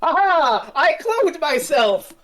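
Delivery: high, loud, hammed-up villain boast; gloating villain. Borad revealing his clone to the Doctor, gloating. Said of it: "it was his clone that was killed".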